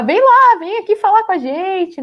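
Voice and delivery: acted-out shout to come over; calling someone over. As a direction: call out eagerly to get someone to come.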